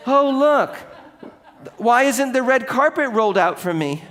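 put-on high, loud whiny entitled kid; entitled brat voice. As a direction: play a spoiled entitled twit. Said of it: "They will think".

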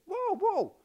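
high, sudden whoa whoa; startled protest. Pretend. Objecting to forgiveness claim.

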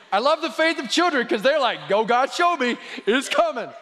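high, loud burst of delight; excited preaching. Real, not acted. Preacher praising the faith of children, high and excited. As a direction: exult in it, pitch well up.

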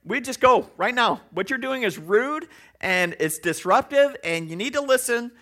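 a hint of a stern youth leader; light mock lecture to rowdy boys; lecturing. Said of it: "he lectures them".

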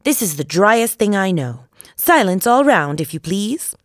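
self-important. A narrator's reading. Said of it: "with an important air".

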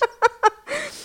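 squealing laugh; high laughing fit. Real, not acted. Big laugh.

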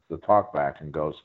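usual speaking voice

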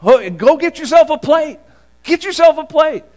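high, welcoming Southern mom urging you to eat; friend's Southern mom. Retold or imitated. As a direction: pitch up high as a hospitable mom offering a plate. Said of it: "his mom would always say to me".

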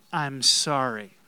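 slow, loud model apology; leading group repetition. Shown as a demonstration. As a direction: lead the congregation in saying it together, slowly. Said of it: "Let's just practice it together".

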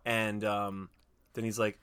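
normal talking voice

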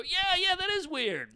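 high, loud excited agreement; eager agreement. Acted. Audience member agreeing with the comic, excitedly validated. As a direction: pipe up like a delighted audience member.

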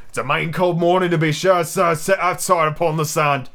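put-on pirate grumble; complaining. A narrator's reading. Long John objecting to the cold sand, complaining. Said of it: "complained Long John".

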